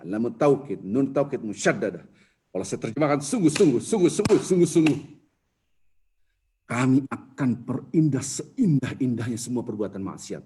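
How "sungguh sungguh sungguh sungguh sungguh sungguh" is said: sungguh-sungguh punched louder each time; building insistence on sungguh-sungguh; emphatic repetition. Shown as a demonstration. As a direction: hammer each sungguh-sungguh home. Emphatic translation of Arabic particle.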